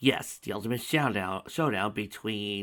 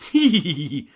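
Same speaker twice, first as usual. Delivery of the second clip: high, much louder villain laugh; loud evil laugh; 8 semitones higher, 14 dB louder than usual. A narrator's reading. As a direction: cackle at him.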